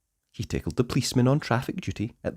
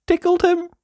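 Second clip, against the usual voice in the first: gleeful; 6 dB louder than usual. A narrator's reading. Punchline of the railway guard tickle.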